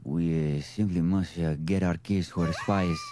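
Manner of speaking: put-on low, suave Spanish-accented film hero; Antonio Banderas. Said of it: "That was my Antonio Banderas".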